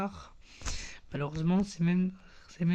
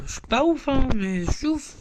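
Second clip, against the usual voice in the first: higher, teasing fake boast; mock bragging; 6 semitones higher than usual. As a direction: pretend to show off about your account, jokingly. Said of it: "Non, je rigole". (fanfaronnade pour rire, voix plus aiguë et taquine)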